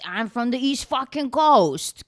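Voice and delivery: hammed-up Boston accent, proud. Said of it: "that's how they talk".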